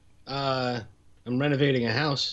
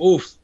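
tired oof; 4 semitones higher, 8 dB louder than usual. Retold or imitated. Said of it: "you're just like".

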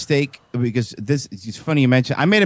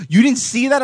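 excited grandfather; 9 semitones higher, 3 dB louder than usual. Retold or imitated. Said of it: "He goes".

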